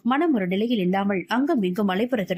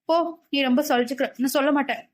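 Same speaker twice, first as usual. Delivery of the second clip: put-on higher-pitched, pouting girl voice; sulky refusal; 4 semitones higher, faster than usual. Malar refusing to tell her scolding friend, sulky. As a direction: pout and refuse like a hurt friend. Malar sulks at his weary complaint.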